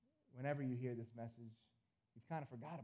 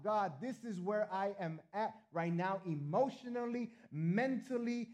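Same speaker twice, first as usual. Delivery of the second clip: higher, louder pleading cry; cry out to God; 5 semitones higher, 7 dB louder, slower than usual. Pretend. Pastor voicing a worshipper crying out to God, loud plea. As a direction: lift the prayer up in a loud plea. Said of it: "you cry out".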